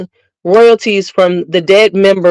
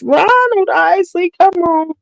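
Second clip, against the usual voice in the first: exasperated; 9 semitones higher than usual. Real, not acted. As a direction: stretch his name out in disbelief. Exasperated at [name] praise.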